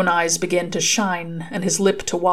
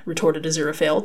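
ordinary delivery, nothing marked

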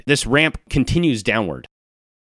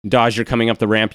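ordinary talk, nothing marked

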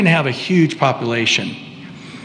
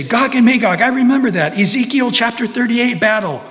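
higher, eager know-it-all listener voice; imagined listener; 7 semitones higher than usual. Pretend. Preacher voicing a congregant who is sure he knows, eager and higher. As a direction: play the listener who thinks he's got it. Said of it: "Now you say".

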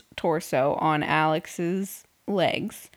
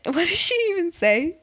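normal talking voice

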